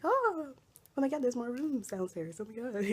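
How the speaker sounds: high-pitched imitation of excited visitors; squealed mock amazement; excited discovery. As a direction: exclaim in mock delight, starting high. Imitating people discovering rooms downstairs.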